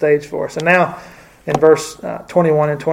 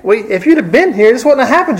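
high reproachful rebuke; mock accusation, clearly higher; accusing Martha; 7 semitones higher, 4 dB louder than usual. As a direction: blame him, pitch raised. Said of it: "Could this have been an accusation?".

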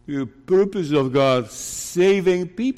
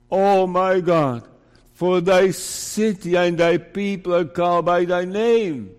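fervent prayer reading; 4 dB louder than usual. Acted.

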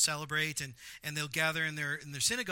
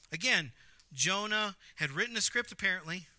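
normal talking voice

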